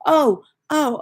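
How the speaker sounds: high, loud retold "oh, oh"; sudden realisation. Son finally works out mum's word, retold realisation. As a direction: act out the penny dropping. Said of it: "He says".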